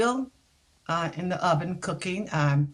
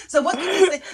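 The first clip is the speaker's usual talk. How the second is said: laughing quote of interviewer; 10 semitones higher, 5 dB louder, faster than usual. Retold or imitated. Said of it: "he said to me".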